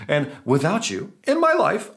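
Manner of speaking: playfully overwrought plea, spoken like a lyric; mock-melodramatic song lyric. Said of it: "that's a song, I didn't sing it".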